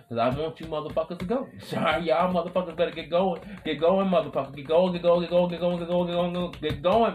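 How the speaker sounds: high, loud get going chant; rally them with a rising yell; hyped yell. Real, not acted. Podcaster yelling at listeners to get going, loud and high.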